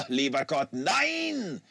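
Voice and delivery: German exclamation spat out, ending high; plosive disgusted German. Acted. Stitt dismisses Mario's question with a plosive, disgusted German cry. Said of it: "with a plosive, disgustive sound".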